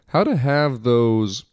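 normal talking voice

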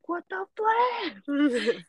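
high, slow drawn-out what the flip; mock disbelief. Scammer caught by hacker, high slow disbelief. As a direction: stretch it out in squeaky shock.

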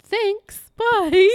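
high, loud squeaky thanks. Pretend. Squeaky joke sign-off.

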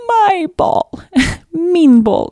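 put-on high, tiny toddler voice on the verge of tears. Mother imitating her crying toddler claiming the ball, high and pleading. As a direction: squeak it out like an upset little girl. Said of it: "she points and she's like".

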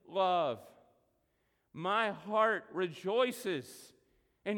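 very slow, sincere declaration; trusting, slow reading. Acted. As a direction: savour each word of trust. Reads the psalm's end.

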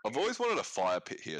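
ordinary delivery

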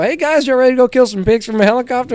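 high, quick hype-up to clients; eager guide greeting his group; upbeat greeting. Retold or imitated. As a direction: rattle it off brightly in a high pitch. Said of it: "I walk up and I go".

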